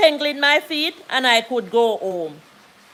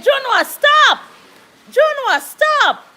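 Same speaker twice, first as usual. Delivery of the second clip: high sharp shouts at the dog, the second one slower; shouting stop; 12 semitones higher, 5 dB louder, slower than usual. Retold or imitated. With a headache, she yells at her dog to stop. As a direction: yell at the dog to stop, head pounding. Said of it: "So I was saying".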